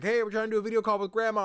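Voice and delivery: high, loud, hurried mom calling out. Retold or imitated. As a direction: mimic a mom yelling from the other room. Mom interrupts the video call.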